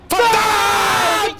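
loud frustrated shout; panicking gamer. Real, not acted. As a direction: yell it out in frustration. Gamer loses fight.

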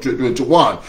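normal talking voice

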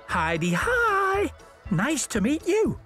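very high, drawn-out character hello; tooting engine greeting. A narrator's reading. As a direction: toot it out like a friendly little engine. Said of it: "tooted an engine named Lexi".

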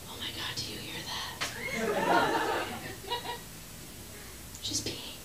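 scandalized whisper; gossiping whisper. Retold or imitated. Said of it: "they're gonna be like".

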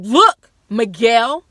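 loud, firm retold command; firm mother. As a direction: hold it together, loudly laying down the law. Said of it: "She's like".

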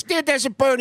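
excited high yelp. Pretend. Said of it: "Bobby goes".

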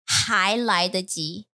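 还来得及 said slowly, word by word; slow teaching demo. Shown as a demonstration. Teacher shows a learner the phrase for still time, slowly. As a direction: model 还来得及 slowly and deliberately. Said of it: "That 还 means there's still time".

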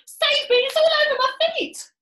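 very high, loud panicked shrieking; screamed. A narrator's reading. Missus Twit with it crawling on her feet, screaming. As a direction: squeal for rescue in terror. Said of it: "screamed Missus Twit".

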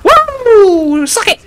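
ordinary delivery

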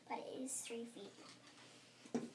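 breathy whispered reveal; whispering how long the bracelet is. Real, not acted. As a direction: whisper it like a little secret. Secret-like reveal of bracelet length.

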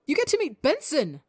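high, thrilled exclamation; bursting with excitement. Acted. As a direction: squeal it out with pure joy. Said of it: "boundless excitement and joy".

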